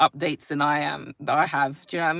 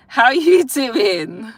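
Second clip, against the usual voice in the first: put-on laughing at own accent; 6 semitones higher, 8 dB louder, much slower than usual. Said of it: "Where did that accent come from?".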